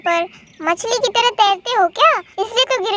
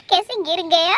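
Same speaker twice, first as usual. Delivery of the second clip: usual speaking voice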